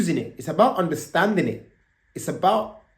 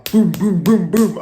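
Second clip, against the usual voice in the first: loud, punchy knocking booms; imitated door banging; 5 semitones higher, 8 dB louder, slower than usual. Police pounding on the girl's door, loud booms. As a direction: bang them out like fists on a door.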